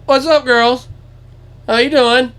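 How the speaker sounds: put-on high, loud greeting to the girls; imitating dad hailing deer; sweet-talking. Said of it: "He goes".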